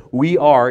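"We are" hit hard and loud; emphatic "We are". Shown as a demonstration. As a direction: lean on "We are". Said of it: "is in the emphatic form".

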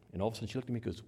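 usual speaking voice

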